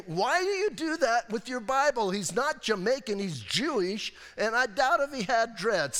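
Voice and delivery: mock outraged viewer; exasperated. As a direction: scold the TV show. Exasperated rant at the show.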